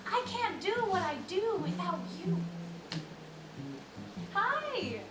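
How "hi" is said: ordinary delivery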